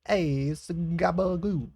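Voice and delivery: high, loud, slow New York caricature; New York accent. Acted. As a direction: stretch it out in mock New York style. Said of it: "Or, like, New York, like".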